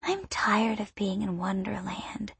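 put-on whispered, small and childlike; innocent whisper. A narrator's reading. Little girl complaining to the boy, whispered. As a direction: mumble it like a drowsy little girl. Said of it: "she mumbles in a milky voice of innocence".

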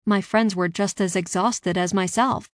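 usual speaking voice

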